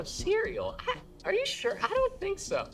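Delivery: very high, startled disbelief; shocked squeak. Retold or imitated. Son caught off guard by his mom.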